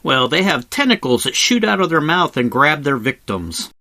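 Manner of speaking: usual speaking voice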